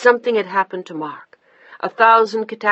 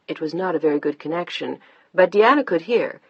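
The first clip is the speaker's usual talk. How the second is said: ordinary delivery, nothing marked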